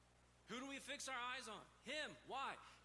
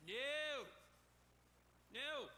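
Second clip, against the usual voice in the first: emphatic refusal; 3 semitones higher, 4 dB louder than usual. Acted. Cuts off imagined objection.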